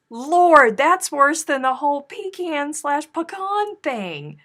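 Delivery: exasperated outburst. Real, not acted.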